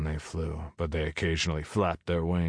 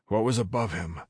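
ordinary delivery, nothing marked

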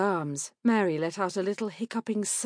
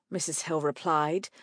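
ordinary delivery, nothing marked

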